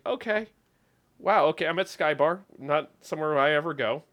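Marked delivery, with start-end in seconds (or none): 0.1-1.7 bemused surprise. Retold or imitated. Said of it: "you're just like".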